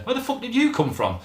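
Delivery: high, baffled question; mock bewildered. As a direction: imitate a defender caught out. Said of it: "as if saying".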